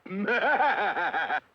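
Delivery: rapid, rattling evil laugh; villainous chuckle. Acted. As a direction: snicker like a scheming villain. Villain laughs at trusting heroes.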